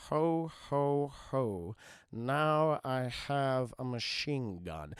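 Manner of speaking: low, very slow, deliberate villain read; ominous, measured mock-villain monotone; villain reading a note. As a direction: drop the pitch and spell out each word slowly. Hans reads out the written message.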